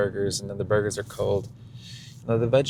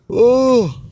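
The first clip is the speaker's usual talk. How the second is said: tired groan; 14 dB louder than usual. Real, not acted. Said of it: "so tired".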